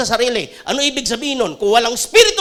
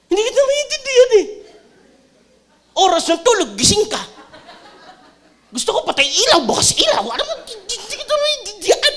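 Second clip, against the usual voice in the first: much higher, exasperated wife; wife's complaint; 8 semitones higher than usual. Retold or imitated. Pastor imitating his wife complaining she cannot understand him, higher and exasperated. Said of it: "sabi ko ... galit ba".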